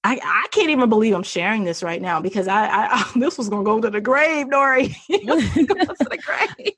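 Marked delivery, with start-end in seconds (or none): 3.1-6.7 laughing, pitched up; embarrassed laugh. Real, not acted. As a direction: giggle through the confession.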